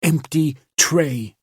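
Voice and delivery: slow bitter hiss. A narrator's reading. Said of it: "she hissed".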